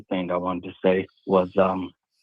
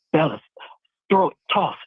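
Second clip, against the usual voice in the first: much higher mock call to the crew; imitated calling out; 8 semitones higher than usual. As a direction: retell a man's order to his mates, pitched up. Quoting men calling out on boat.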